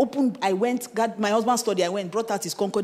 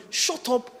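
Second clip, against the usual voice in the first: usual speaking voice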